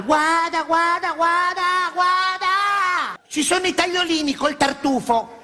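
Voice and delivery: high, slow gushing exclamations; excited girlfriend. Retold or imitated. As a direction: gush over the menu.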